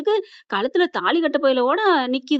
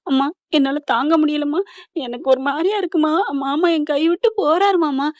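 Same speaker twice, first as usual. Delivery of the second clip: imitating a distressed daughter, louder than usual; retold tearful plea; 3 semitones higher, 4 dB louder than usual. Daughter telling mother she cannot bear it, mock-tearful. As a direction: plead as if close to tears, lightly acted. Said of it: "மறுகி மறுகி அழுகுற".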